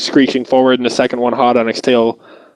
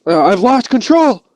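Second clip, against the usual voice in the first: high, tense panicked voice; helpless fear; scared driver; 11 semitones higher than usual. Retold or imitated. Driver telling partner the car is locked, scared.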